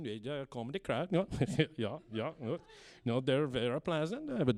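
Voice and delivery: put-on low, soft Scandinavian-accented murmur into a phone; Scandinavian phone chat. Detective takes a call from his wife, in a low Scandinavian-accented murmur. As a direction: mumble Norwegian to your wife on the phone.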